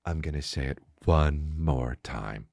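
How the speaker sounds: low, slow, soft and controlled; hypnotizing. Acted. Imitating him holding anger in, soft hypnotic voice. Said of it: "Like he's hypnotizing you".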